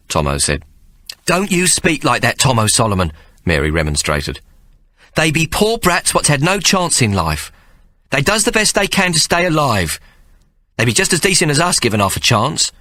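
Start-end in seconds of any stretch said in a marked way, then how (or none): 1.3-12.7 higher motherly voice, a little weary; mother Mary. Acted. Mary complaining to Ike about the boys, higher mother voice.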